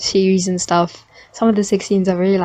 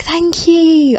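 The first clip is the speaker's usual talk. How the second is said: high, louder gushing thanks; delighted thank you; 9 semitones higher, 4 dB louder than usual. Retold or imitated. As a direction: beam with gratitude. Thanking high school praise.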